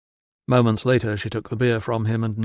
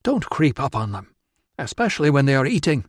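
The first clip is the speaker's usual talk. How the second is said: put-on tart northern tribeswoman accent, quickening at the end; 3 semitones higher than usual. Tribeswoman scolds the stranger who crept up, northern accent. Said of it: "with the accent of a tribeswoman from farther north".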